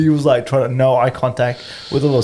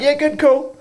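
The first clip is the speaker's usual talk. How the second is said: high-pitched, tossed-off brush-off; breezy retold reply; 13 semitones higher than usual. Runner answering old people while passing, breezy. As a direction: pitch it up, polite and noncommittal. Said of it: "I'm like".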